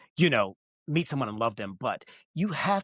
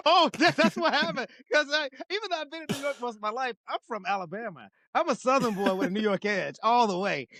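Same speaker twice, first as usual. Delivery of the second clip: high-pitched, laughing protest; cracking up, voice pitched way up; laughing explanation; 12 semitones higher than usual. Real, not acted. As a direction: explain it through helpless laughter. Laughing at own accent slip.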